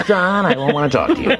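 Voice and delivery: high, swooping radio-host rebuff; Casey Kasem. Retold or imitated. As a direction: play a mock-stern DJ dad dismissing his son. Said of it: "And he goes".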